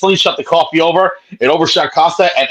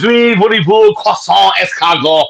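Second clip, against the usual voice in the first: hammed-up French accent; mock French accent; 5 semitones higher, slower than usual. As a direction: fake French, high and playful. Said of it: "I was trying to speak French".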